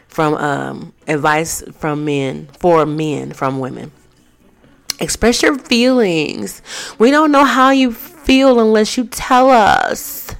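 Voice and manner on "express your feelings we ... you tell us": high, drawn-out whiny plea; mock pleading woman. Shown as a demonstration. Vlogger mimicking a woman pleading with her man. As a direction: ham up a girlfriend begging him to open up. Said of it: "Yeah, I said it just like that".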